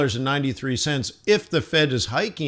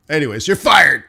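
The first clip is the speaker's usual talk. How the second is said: louder, blunt "fired" dropped flatly; blunt firing; 2 semitones higher, 7 dB louder than usual. Retold or imitated. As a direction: fire him bluntly, louder. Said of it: "That's how they say it".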